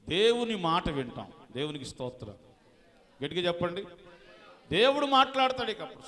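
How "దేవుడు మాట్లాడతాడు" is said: high, loud preaching cry; loud higher call that God speaks; preaching proclamation. Real, not acted. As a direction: proclaim it to the whole church, higher and louder.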